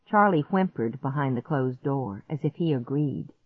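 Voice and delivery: ordinary delivery, nothing marked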